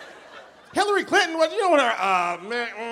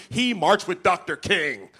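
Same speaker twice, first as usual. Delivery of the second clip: quoting supporters; 5 semitones lower, slower than usual. Retold or imitated. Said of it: "supporters always use the same argument".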